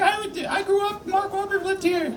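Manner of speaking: high, soft mock aunt voice; aunt impression. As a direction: squeak it like a proud aunt. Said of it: "She's like".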